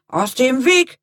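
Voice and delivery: loud, irate command; angry shout. A narrator's reading. Hurried man to Lea in his path, angry shout. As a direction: shout it furiously at someone in the way. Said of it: "rief der Mann wütend".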